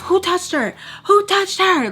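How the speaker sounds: high-pitched mock outrage; going off. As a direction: imitate someone storming in and demanding answers. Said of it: "Like, talking like that, talking crazy".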